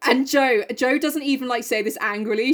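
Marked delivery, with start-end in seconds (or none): none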